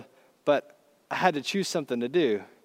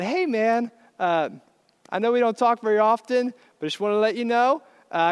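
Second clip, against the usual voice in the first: higher-pitched, sheepishly casual message; raised-pitch, casual phone-message voice; voicemail to neighbor; 5 semitones higher than usual. Retold or imitated. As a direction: act out an awkward confession on voicemail. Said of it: "leave a three-minute long voicemail".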